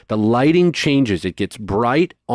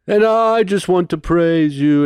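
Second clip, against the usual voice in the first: high, louder worship call; imitated preacher; 7 semitones higher, 4 dB louder than usual. Preacher uncle opening the healing song. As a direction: stir the crowd into praise. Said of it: "And he says".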